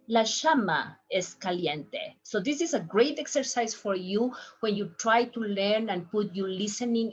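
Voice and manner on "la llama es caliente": llama with a sh sound, "shama"; third way. Shown as a demonstration. Teacher demos the Argentine sh llama. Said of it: "three different sounds".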